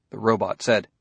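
ordinary delivery

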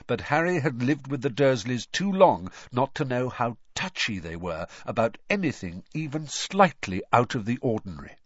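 usual speaking voice

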